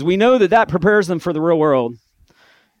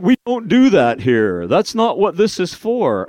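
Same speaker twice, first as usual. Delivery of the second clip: stern teacher; 5 semitones higher, 4 dB louder than usual. Retold or imitated. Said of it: "They're like".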